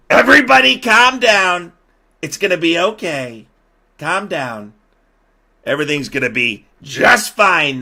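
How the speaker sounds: high, loud call for order; mock calming shout. President settling two squabbling aides, mock shouting. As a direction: belt out the reassurance over a squabble. Acted Trump settling the quarrel.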